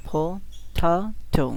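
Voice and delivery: Potato slowly in three beats, টা strong; drawn-out potato with emphatic second syllable; stress on middle syllable. Shown as a demonstration. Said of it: "এটা একটু জোরে বলতে হবে".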